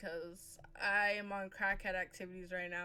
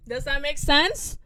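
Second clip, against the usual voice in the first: louder, higher, drawn-out question; loud check; 5 semitones higher, 12 dB louder than usual. Real, not acted. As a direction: call it out for an answer. Repeats loudly to get a response.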